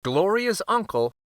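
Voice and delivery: usual speaking voice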